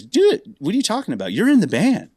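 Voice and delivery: a retold eager shout; excited insistence. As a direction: push it like you can't believe he asked. Singer insists he's in band.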